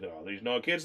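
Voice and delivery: put-on low, soft grumble of an old man; grumpy old man. Old man muttering about kids, low and soft. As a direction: mutter it like a crank.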